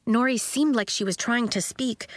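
usual speaking voice

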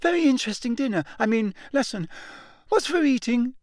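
rushed, very fast and unnaturally high; words tumbling out in a panic; rattled boy babbling nonsense, fast and high; gabbling, high voice. A narrator's reading. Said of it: "gabbled in the same unnaturally high voice".

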